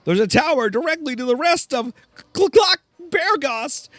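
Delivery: exasperated high-pitched chicken mage; very high, flustered talking chicken; Mellencamp. Acted. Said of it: "my Mellencamp voice".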